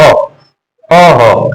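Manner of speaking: अ released into a throaty ह; throat after mouth. Shown as a demonstration. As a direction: sound अ, then push ह from the throat. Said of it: "फिर उसके बाद कंठ से बज रहा है".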